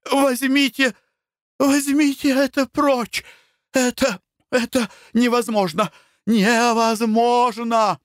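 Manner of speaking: high, sobbing panicked shriek; hysterical cry. A narrator's reading. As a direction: scream it away in terror. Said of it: "разразилась рыданиями".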